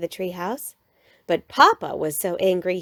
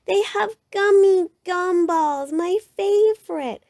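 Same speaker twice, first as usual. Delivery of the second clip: high, slow pleading whine; whining cub; 10 semitones higher, 4 dB louder than usual. A narrator's reading. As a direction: draw out the want in a high child voice. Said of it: "whined Brother".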